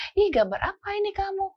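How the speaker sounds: high, bright mock-curious mom voice; curious mother. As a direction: play a mother peering at a scribble. Mother admiring child's drawing.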